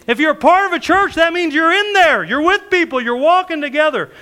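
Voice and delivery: loud, high preaching cadence; full-force preaching. Acted. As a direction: drive the point home to the congregation.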